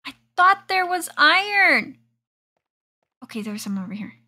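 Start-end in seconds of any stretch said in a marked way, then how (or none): 0.1-2.0 high, loud exasperated cry; loud annoyed complaint; frustrated outburst. Real, not acted. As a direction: blurt it out, annoyed. Frustrated at missing iron.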